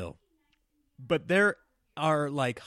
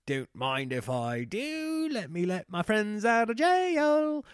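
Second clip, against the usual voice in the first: slow, drawn-out smug voice; mock president; 7 semitones higher, 4 dB louder, slower than usual. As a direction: savor the pardon smugly. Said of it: "I'm sure they're like".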